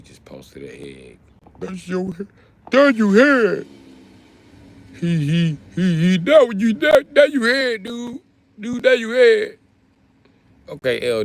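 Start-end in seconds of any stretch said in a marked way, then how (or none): 1.6-2.3 high, soft mocking squeak. Streamer mimicking a chat commenter, high and mocking. First, softer take. 2.7-3.7 high, loud mocking yell of the same line. Streamer yelling a chat commenter's line back in mock voice. 5.0-9.6 high, loud sneering caricature; high mocking voice. Streamer mocking the chatter in a squeaky voice.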